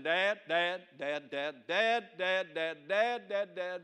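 imitating a kid idly repeating dad; routine repeated calling. Kid sitting around saying Dad a hundred times, routine nagging. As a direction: chant it over and over, no urgency. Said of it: "he can say it a hundred times".

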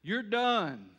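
lightly voiced stern father, loud and final "You're done"; a hint of Daddy. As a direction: say it like Daddy closing the matter. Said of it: "There's a time when Daddy says".

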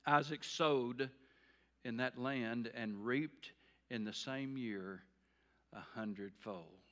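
measured scripture reading, fading on the last word. Shown as a demonstration. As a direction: let the verse land piece by piece. Verse read slowly for effect.

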